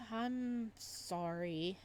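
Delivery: very slow, soft, after a sigh; settled, sincere sorry; calm sincere apology. Acted. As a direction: let the breath out and apologize gently. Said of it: "I need to chill out".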